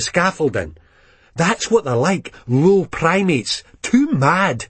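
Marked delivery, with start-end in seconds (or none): none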